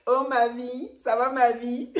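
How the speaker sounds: high sing-song pet names; mock sweet-talker. YouTuber warning about gushing people, high mock-sweet imitation. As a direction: parody a syrupy well-wisher. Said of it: "tout le temps là, en mode".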